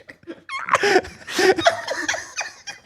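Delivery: high, crying with laughter; wheezing laugh. Real, not acted. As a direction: laugh till it hurts. Long laugh.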